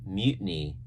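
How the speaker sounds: ordinary delivery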